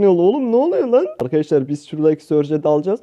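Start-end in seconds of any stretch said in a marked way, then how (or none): 0.0-1.1 alarmed outburst. Real, not acted. Teammate acting strange in match.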